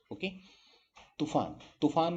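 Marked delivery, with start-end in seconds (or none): 1.2-2.1 तूफान with a short u in तू; higher तूफान with clipped तू; short vowel. Shown as a demonstration. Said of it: "तूफान नहीं होता".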